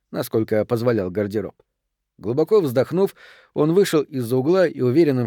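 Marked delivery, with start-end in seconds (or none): none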